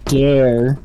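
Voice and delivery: slow, spaced-out threat; warning. Acted. Player warns the commander off the subject, slow and threatening. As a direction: stretch each word as a warning.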